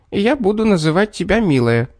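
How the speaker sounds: high, pleased little man; smug little man. A narrator's reading. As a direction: announce it proudly, pitched high.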